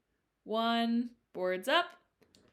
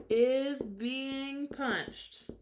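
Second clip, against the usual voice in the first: drawn-out, emphatic; slow emphasis; 5 semitones higher, 3 dB louder, much slower than usual. Shown as a demonstration. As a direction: stretch each word out. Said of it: "Correct answer".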